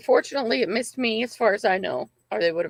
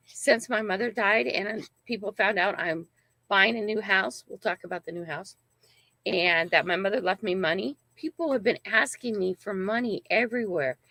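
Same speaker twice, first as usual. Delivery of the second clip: ordinary delivery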